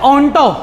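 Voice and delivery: onto ending in a long 'o', like 'on-toh'; maths-style pronunciation. Shown as a demonstration. Teacher shows students how onto is read, 'on-toh' pronunciation. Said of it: "इसे पढ़ते हैं".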